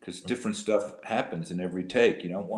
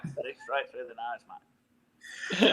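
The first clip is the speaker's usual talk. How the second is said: nasal Aussie accent; 5 semitones higher, much slower than usual. Shown as a demonstration. Showing the panel how Australians talk, nasal Aussie accent. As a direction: push the Australian accent slowly through the nose. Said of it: "Australians speak through their nose".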